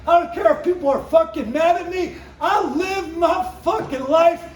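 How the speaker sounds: high, loud, punched-out stage tirade; shouted stand-up rant. Acted. Comedian signing off to a crowd, defiant shouted rant. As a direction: belt it at the crowd, hammering every swear word.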